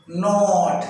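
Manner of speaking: not में आ और ओ के बीच की ध्वनि; pronouncing not. Shown as a demonstration. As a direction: say not with a vowel between aa and o. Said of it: "इसका जो pronunciation है". (not को आ और ओ के बीच वाली आवाज़ में बोलिए)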